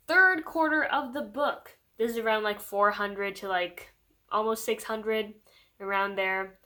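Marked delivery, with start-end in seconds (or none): 0.1-1.8 announcer voice. Shown as a demonstration. Announces new section.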